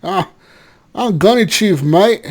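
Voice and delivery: put-on Australian accent. Joking on the podcast in a light Australian accent. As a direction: say it like a cheeky Aussie bloke. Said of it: "Just doing this accent".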